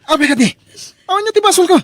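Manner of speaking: high, loud panicked yelling; frantic shout. Acted. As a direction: scream to make her stop. Husband shouting during fight.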